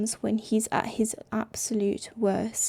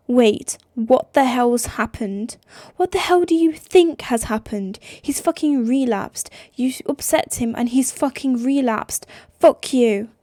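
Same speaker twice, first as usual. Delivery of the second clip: loud, raging swearing; furious yelling; 5 dB louder than usual. Acted. Sister swearing at Nick over the phone, raging loud. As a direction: spit the words at him.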